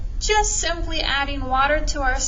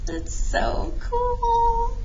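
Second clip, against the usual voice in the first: higher, slow, drawn-out awe; excited wonder; 10 semitones higher, much slower than usual. Real, not acted. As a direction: savour it, delighted and slow. Reacting to the cup sound.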